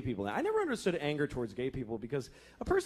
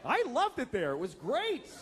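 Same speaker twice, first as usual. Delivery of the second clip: high-pitched, hammed-up delight; gleeful enthusiasm; 10 semitones higher than usual. Former camper recalling summer camp, gleeful gushing. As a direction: gush about it in a raised voice.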